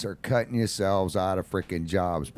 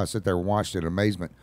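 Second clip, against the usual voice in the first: ordinary talk, nothing marked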